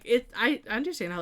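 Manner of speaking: normal talking voice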